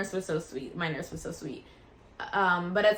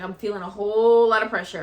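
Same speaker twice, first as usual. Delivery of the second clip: louder, pressing urgency; urgent louder report; more urgent; 8 dB louder than usual. Retold or imitated. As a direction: push the words out with urgency. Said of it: "and I was like".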